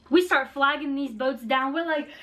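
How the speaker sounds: ordinary talk, nothing marked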